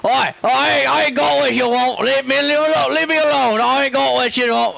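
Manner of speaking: high, pleading character voice; panicked injured character. Acted. Wounded man begs attackers off, panicked wail. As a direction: wail it in a high, put-on accent. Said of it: "I'm doing the wrong voice here".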